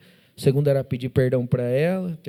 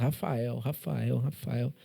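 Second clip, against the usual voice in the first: hushed, softer repeated calling of the name; soft inner voice calling; 3 semitones lower, 9 dB quieter, much slower than usual. Retold or imitated. Voice in his head softly calling his name, hushed. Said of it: "vinha na minha cabeça".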